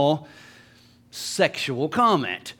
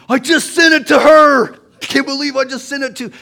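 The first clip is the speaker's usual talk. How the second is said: much higher and louder, horrified; panicked shriek; 10 semitones higher, 12 dB louder than usual. Retold or imitated. Texter realizing she sent it to her. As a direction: yelp in horror at the sent text.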